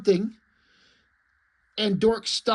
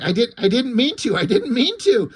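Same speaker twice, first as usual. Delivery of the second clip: higher-pitched, rising panicked protest; shocked; 4 semitones higher, 4 dB louder than usual. Acted. Dork to the onlookers after killing the chicken, shocked. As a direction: plead it in shock, voice climbing higher. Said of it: "So he's shocked".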